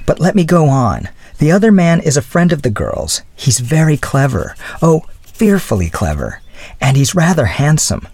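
lightly voiced young woman making excuses; flustered young woman. A narrator's reading. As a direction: lift the voice for a flustered girl.

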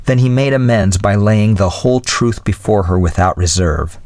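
usual speaking voice

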